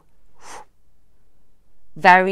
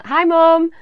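loud, raised greeting to Mum; calling out; 7 semitones higher, 9 dB louder than usual. Real, not acted. Saying hi to Mum watching on screen, calling out. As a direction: call out a big hello.

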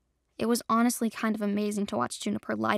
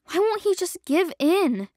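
whine; 8 semitones higher, 5 dB louder, slower than usual. Acted. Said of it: "Juniper whined".

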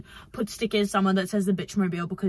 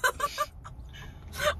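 high squealing laugh through pain; 6 dB quieter than usual. Real, not acted. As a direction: laugh and wince at once. Laughing after teeth pain.

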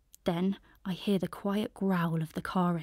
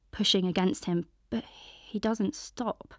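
usual speaking voice

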